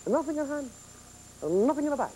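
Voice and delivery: playful mock Polish accent in broken English. Magician showing a bag trick to the viewers, in a mock Polish accent. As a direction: patter through the trick in a hammed-up Polish accent. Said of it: "Here I go to my Polish accent again".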